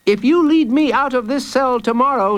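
put-on high-pitched child's voice; bold child. Jacob makes his threat to Hooded Fang, bold. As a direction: bargain boldly in a child's high voice.

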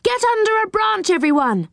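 fast, high-pitched urgent order; rushed high cry of alarm; yelled warning. A narrator's reading. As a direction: call everyone to cover in a hurry. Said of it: "yelled Joe".

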